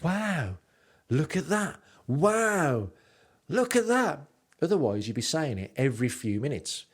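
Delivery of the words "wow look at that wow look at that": rising, hammed-up wow, each one higher; mock wowed tourist. Vlogger mocking a constantly amazed tourist, hammed-up wow. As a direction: overdo the amazement more each time. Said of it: "you're not gonna be constantly going".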